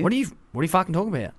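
quick, rough Aussie comeback; mock Aussie retort. An Aussie host mocking a confused local, quick and blunt. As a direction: fire it off fast like a blunt Aussie. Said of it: "We go".

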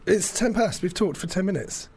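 ordinary talk

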